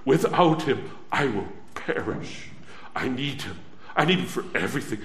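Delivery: low pleading murmur. Acted. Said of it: "It comes to".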